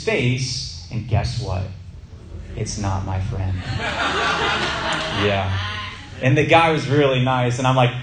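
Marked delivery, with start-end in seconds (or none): 3.6-5.2 bursts into a high laugh; real laugh breaking out; laughing at his own mix-up. Real, not acted. Laughing at mistaken identity.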